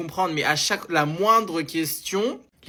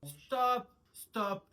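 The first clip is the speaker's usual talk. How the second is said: fading stop; 6 semitones higher, 4 dB quieter, much slower than usual. Acted. The son pushing the mother's friends out. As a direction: cry stop and wind down.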